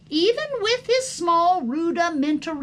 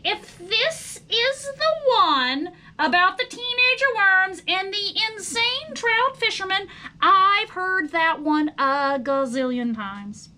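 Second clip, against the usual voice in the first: higher, louder, sneering little worm; sarcastic sneer; 6 semitones higher, 6 dB louder than usual. Acted. Son mocks father's tired story, sarcastic sneer. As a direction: roll your eyes at dad's old story. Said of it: "sneered sarcastically".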